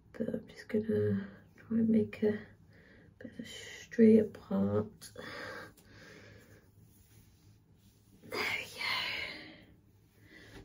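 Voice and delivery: soft whisper to herself. Real, not acted. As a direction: whisper while she works. Quiet muttering while parting hair.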